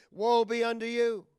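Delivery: usual speaking voice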